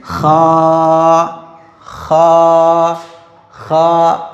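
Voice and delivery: खा with a scraping throat friction; throat rasp. Shown as a demonstration. Teacher demonstrates खा to learners, raspy throat. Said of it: "इसकी आवाज़ कैसे निकलेगी".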